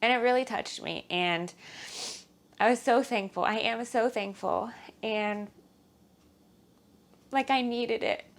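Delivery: quietly tearful thanks; teary. Real, not acted. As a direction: still welling up, voice catching. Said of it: "I don't know why I'm crying now".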